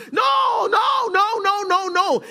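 high, loud, repeated no; preacher's rising shout of refusal. Acted. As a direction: cry out no, no, no. Preacher crying out denial.

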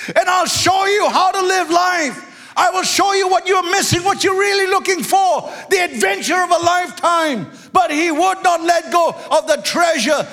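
high, shouted sermon voice; full-force preaching. Acted. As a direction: preach it out to the whole congregation.

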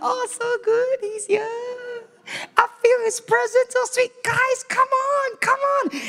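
very high, breathy mock rapture, slowing at the end; mock gushing worshipper. Mocking churchgoers swooning at the door, high mock rapture. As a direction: ham up an overwhelmed worshipper calling friends.

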